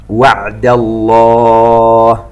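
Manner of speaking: slow chanted tajweed recitation; Quran recitation. Acted. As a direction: draw out each vowel, chant it. (تلاوة مرتلة بطيئة)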